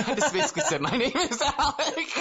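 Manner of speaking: words bubbling out through laughter, high and fast; can't hold back the giggles while explaining; laughing while talking. Real, not acted. Cracking up at own story.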